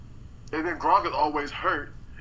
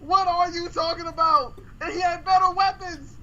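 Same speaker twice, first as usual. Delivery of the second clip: heated high rant; 10 semitones higher than usual. Real, not acted. Heated defense of Brady.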